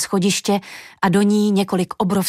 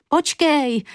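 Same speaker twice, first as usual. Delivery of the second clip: calling out; 7 semitones higher than usual. A narrator's reading. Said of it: "volala marně Julinka".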